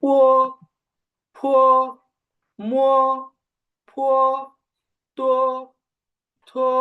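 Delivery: each syllable said slowly and apart, teacher model; careful drill read of পো ফো মো; pinyin initials drill. Shown as a demonstration. Teacher reads initials aloud for class. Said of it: "সবকয়টা উচ্চারণ করে যাচ্ছি".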